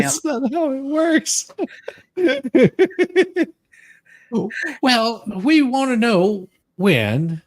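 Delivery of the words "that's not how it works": high giggly repeat; laughing. Real, not acted. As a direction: echo it through laughter.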